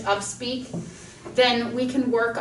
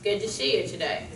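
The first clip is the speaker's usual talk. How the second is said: slack-jawed talking; 3 semitones lower, 4 dB quieter than usual. Shown as a demonstration. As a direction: talk like you just left the dentist numb. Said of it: "relax the muscles in your face and try talking".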